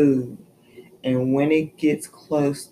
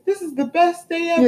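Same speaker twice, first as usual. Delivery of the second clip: mock delight; 12 semitones higher, faster than usual. Child's delight next day, retold.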